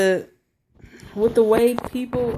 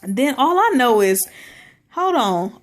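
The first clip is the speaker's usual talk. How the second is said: ordinary delivery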